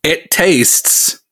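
giggling through the words; laughing. Real, not acted. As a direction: laugh as the taste hits. Laughs trying the beer.

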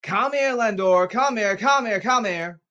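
high, loud, insistent calling; shouted summons. Real, not acted. Ranter calling Lindor out, repeated angry shouts. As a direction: keep yelling for someone to get over here.

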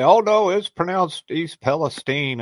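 put-on Palestine said as pal-es-teen, slow and correcting; mocking correction. Imitating outsiders correcting him, Palestine said as pal-es-teen. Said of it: "I've heard people say".

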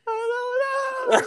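squeaky, drawn-out wail; crying voice. Real, not acted. Wailing to a laughing friend, comical crying voice. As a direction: bawl it high and slow. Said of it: "Why is your crying voice so fucking funny?".

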